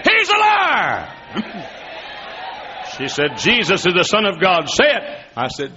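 high, loud, shouting preacher; preaching at full force. Acted. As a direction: preach it out, rising to a yell.

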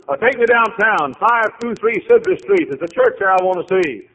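high, loud and eager; eager visitor. Retold or imitated. Visitor asks cab driver for the church, eager and high. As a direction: ask the cabbie like an excited tourist.

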